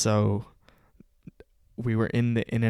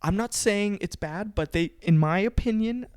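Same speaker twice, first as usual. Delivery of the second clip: higher-pitched, measured mock-mom voice; imitating mom; 4 semitones higher, 8 dB louder than usual. Son retelling mom's careful opinion, higher imitated mom voice. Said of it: "and then Mom goes".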